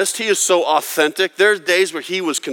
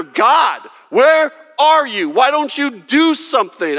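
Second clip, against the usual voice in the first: much higher and louder, desperate plea; anguished cry; 10 semitones higher, 5 dB louder than usual. Retold or imitated. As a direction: shout up at a silent God. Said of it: "David would tell you from experience".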